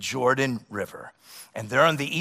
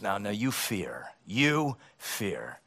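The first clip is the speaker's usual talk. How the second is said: ordinary delivery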